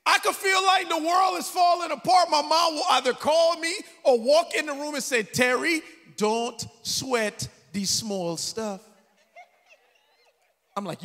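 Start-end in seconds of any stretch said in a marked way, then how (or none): 0.0-5.2 high, loud preacher's cry; preaching shout. Acted. Preaching at full force. 5.2-8.8 very slow, easy, with a laugh; drawn-out calm advice. Retold or imitated. Mother's motto, drawn out.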